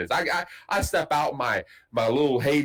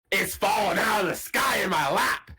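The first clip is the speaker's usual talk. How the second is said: excited brag; 6 semitones higher than usual. Retold or imitated. Bragging on the phone to Amber.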